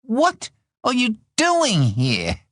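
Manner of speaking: slow, high, emphatic WHAT and DOING; exasperated demand. A narrator's reading. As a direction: demand an answer, drawing out each word. Exasperated third asking after snapping.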